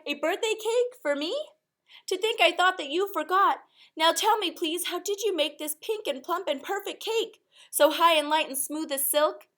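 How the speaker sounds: high, bright-eyed squeal of joy; delighted cry. A narrator's reading. Mother thrilled by her birthday cake, high excited cry. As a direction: gasp it out overjoyed, voice way up. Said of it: "she cried".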